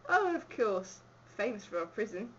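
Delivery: put-on Cockney accent. Host of a town tour to a visitor, Cockney accent. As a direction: play it as a Cockney. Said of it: "I'll do a Cockney accent".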